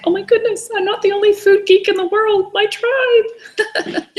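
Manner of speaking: overjoyed high-pitched squeal of recognition; delighted squeal. Retold or imitated. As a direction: gush like you found your people. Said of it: "And I'm like".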